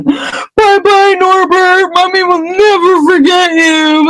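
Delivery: high, wailing mock-tearful goodbye; hammed-up weepy send-off to a baby dragon; mock sobbing farewell. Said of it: "And Hagrid's crying".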